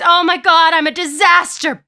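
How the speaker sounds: high, loud wail of self-blame; dismayed cry. A narrator's reading. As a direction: exclaim in horror at yourself. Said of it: "Lydia cries".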